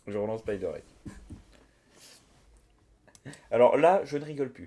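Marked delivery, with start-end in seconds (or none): 3.5-4.7 playfully fed up; mock angry. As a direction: fake serious threat. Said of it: "je vais commencer à m'énerver".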